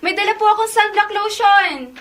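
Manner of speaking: put-on high eager teen reply. Teen answers fussing grandma.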